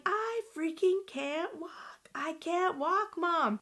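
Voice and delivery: high, drawn-out mock yell; retold yelling. Daughter yelling back at pushing mom, retold. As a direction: re-enact desperate protest, slow and very high. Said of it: "And I'm yelling".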